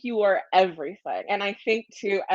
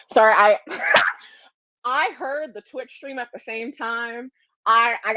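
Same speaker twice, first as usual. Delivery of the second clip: ordinary delivery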